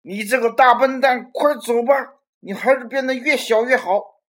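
angry yelling. Acted. Robert yells at the monster to go away, angry shout. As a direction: yell him out of the room. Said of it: "一边冲着怪物叫道".

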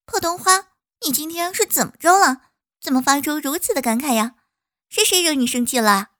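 high, loud, baffled little bee; puzzled bee. Acted. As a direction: ask in puzzled surprise. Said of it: "不解地说".

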